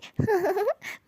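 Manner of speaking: a short giggle that rises high; a quick pleased giggle. Acted. Little brother giggling at his forgiving sister, pleased. Sibling teasing, sister gives in.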